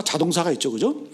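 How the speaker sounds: usual speaking voice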